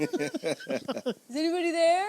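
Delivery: high, loud, stretched-out comic wail; mock tearful call. Host joining the ugly-cry joke, mock wailing. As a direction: draw it out like a hammed-up plea.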